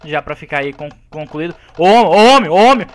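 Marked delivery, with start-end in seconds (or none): 1.8-2.9 loud call across the room. Real, not acted. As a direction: shout out to grab attention. Calling out to friend in game.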